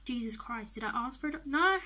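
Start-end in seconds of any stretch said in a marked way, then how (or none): 1.5-1.8 loud, raised-pitch outburst; high, loud refusal; emphatic refusal. Real, not acted. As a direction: shout it in exasperation. Refusing an offered dog.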